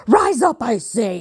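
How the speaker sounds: high, loud furious command; commanding shout. Acted. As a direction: roar the order. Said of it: "he commanded".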